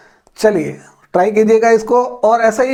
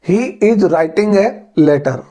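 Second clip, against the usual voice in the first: slow Indian English accent, hard t in "writing" and "letter"; much slower than usual. Shown as a demonstration. Teacher giving the Indian version first, slow Indian English accent. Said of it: "ये तो हुआ Indian English में".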